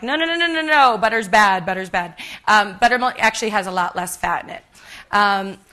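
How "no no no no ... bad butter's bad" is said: mock protest. Cook imitating everyone who hears the word butter, mock protest. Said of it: "everyone's like".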